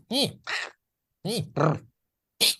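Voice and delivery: little critter grunts and a brr trill; squeaky meerkat noises; animal noises. Shown as a demonstration. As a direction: chirp like a small animal. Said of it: "making animal noises".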